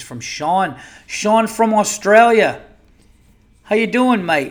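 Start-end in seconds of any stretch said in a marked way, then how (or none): 1.1-2.6 loud, high, drawn-out attempt at Australian; hammed-up Australian, pitched high; Australian accent. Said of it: "I always fuck up the English accent with the Australian". 3.6-4.5 high, loud Aussie attempt that slips toward English; mock Australian accent, unsure of itself; botched Australian accent. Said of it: "I always fuck up the English accent with the Australian".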